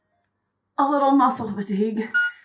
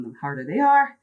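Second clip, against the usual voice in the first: ordinary talk, nothing marked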